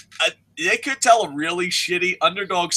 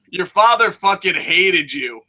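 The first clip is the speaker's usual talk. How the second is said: ordinary delivery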